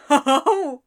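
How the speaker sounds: gleeful fake laughter; mocking laugh. A narrator's reading. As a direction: laugh at his clumsiness. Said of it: "she cried, laughing".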